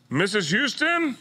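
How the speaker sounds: high, drawn-out questioning call; doctor calling out. Retold or imitated. Doctor summons the waiting celebrity, high questioning call. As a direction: call a patient's name slowly, pitched high. Doctor calls the next patient.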